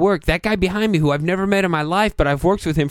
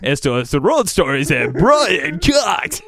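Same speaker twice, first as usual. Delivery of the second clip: mock announcer intro; slower than usual. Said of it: "can I do Brian Cox Road Story in Spanish".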